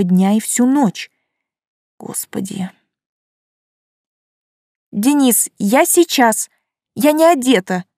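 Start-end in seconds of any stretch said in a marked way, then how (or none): 2.0-2.7 breathless dismayed whisper. Acted. 4.9-7.8 higher, loud call through door; calling back. Acted. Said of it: "крикнула я в ответ".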